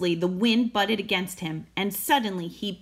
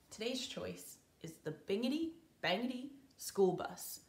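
whisper; 13 dB quieter than usual. Shown as a demonstration. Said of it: "whispered intro".

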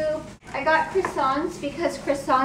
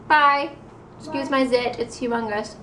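normal talking voice

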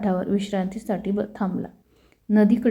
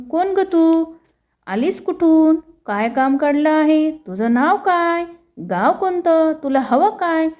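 higher and louder, a servant firing questions; sharp questioning servant; 8 semitones higher, 4 dB louder than usual. Acted. Servant quizzing an old woman at the door, high and loud. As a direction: interrogate the stranger at the door, pitched up.

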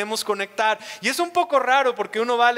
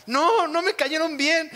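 mock wife; 6 semitones higher, slower than usual. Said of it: "wife disagrees about the staff".

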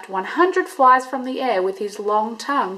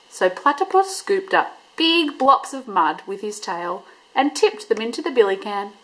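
ordinary delivery

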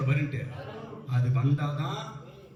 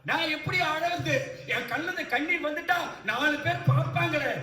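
acted self-conscious worrier; 11 semitones higher than usual. Preacher voicing a churchgoer embarrassed to cry before others, mock protest. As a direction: lightly act out someone fretting over being seen.

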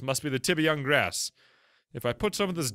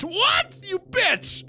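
much higher, shrieked "What? You bitch!" in mock outrage; outraged squeal; 4 dB louder, much slower than usual. Real, not acted. Streamer insulted by the creature, shrieking in mock outrage. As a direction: squeal in offended laughter.

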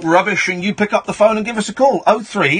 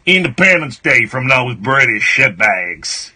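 put-on American accent; 9 semitones lower than usual. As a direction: announce it in a deep mock-American voice. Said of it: "have to do an American accent".

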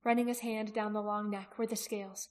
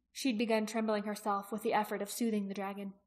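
plain reading voice

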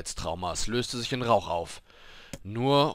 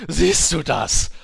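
loud, high, drawn-out call; gleeful shout; 8 semitones higher, 13 dB louder, slower than usual. A narrator's reading. Dancing apothecary showing off his drawing, loud gleeful shout. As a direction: crow it out while dancing for joy. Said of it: "rief der Apotheker, während er tanzte".

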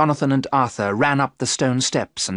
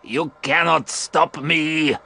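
slow, menacing vampire voice; vampire crying out; 4 semitones higher, slower than usual. A narrator's reading. Dracula defying the three hunters, slow menacing cry. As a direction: defy them as the ancient count. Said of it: "he cried out".

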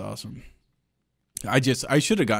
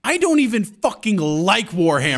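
loud, higher-pitched indignant retort; fed-up complaint, pitched up and louder; exasperated protest; 7 semitones higher, 6 dB louder than usual. Retold or imitated. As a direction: retell it with loud, exasperated disbelief. Retelling his complaint email reply.

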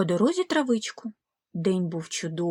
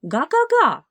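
a loud, higher honk; louder higher honk; distant honk; 6 semitones higher, 6 dB louder than usual. Acted. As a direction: call from afar like a goose. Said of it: "здалеку почулося рідне".

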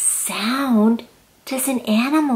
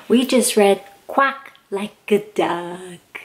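plain reading voice

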